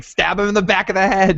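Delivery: high, wild mock rant; hammed-up mad king voice; crazed king. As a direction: play him as unhinged and ranting. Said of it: "He's going crazy".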